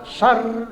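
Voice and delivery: "syar" with short a, held r; tasdid. Shown as a demonstration. Teacher isolating the doubled letter, held r in "syar". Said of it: "ya, tasdid".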